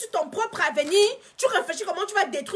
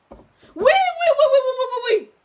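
a high, loud, eager chorus of yeses; the friend squealing her agreement; mock eager yes; 6 semitones higher, 6 dB louder than usual. Friend gushing yes to her, high and loud.

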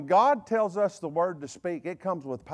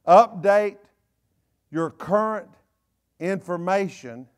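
"Update" hit loud, then "your current information" slow with pauses; spaced emphasis; 6 dB louder, slower than usual. Shown as a demonstration. Preacher spells out the point to the church, each word set apart. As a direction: space the words out like a heading. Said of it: "spaced out word by word".

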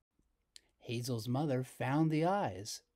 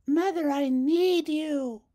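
high, loud, slow wail for mother; much higher, slower anguished call; crying out; 11 dB louder than usual. Acted. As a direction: call for her mother in distress. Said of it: "she cried".

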